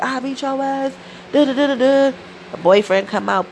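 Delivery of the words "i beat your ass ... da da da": high mocking cousin voice; mock cousin threat. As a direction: pipe up the threat like a cartoon bully. Retold fight, cousin threatening.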